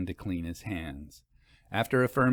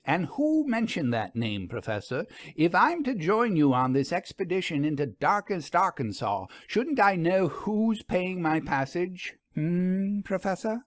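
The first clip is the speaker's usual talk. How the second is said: put-on higher, pointed young woman pressing; Vera; 7 semitones higher than usual. A narrator's reading. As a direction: needle him with a higher, insistent voice. Vera voiced, probing.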